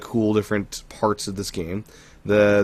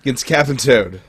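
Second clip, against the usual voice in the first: fighting game announcer; 4 semitones higher, 4 dB louder than usual. Pretend. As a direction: boom out the matchup like a ring announcer. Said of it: "a good announcer voice".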